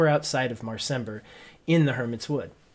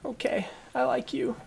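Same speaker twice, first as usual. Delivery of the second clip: put-on child voice; 11 semitones higher, 4 dB quieter than usual.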